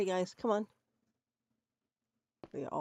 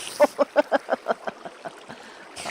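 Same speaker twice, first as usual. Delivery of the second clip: loud high burst of laughter; helpless giggling fit; real laughter; 9 semitones higher, 7 dB louder than usual. Real, not acted. Cracking up at his own joke, loud real laughter. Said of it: "I'm laughing so easily".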